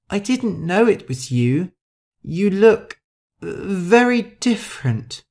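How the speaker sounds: high, hesitant Shu voice; timid Shu. Acted. Shu excusing herself to Rosa, timid and hesitant.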